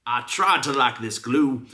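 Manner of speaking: normal talking voice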